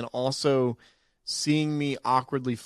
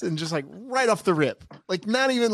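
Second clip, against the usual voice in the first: ordinary talk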